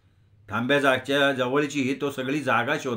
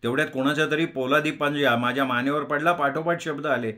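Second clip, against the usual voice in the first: ordinary delivery, nothing marked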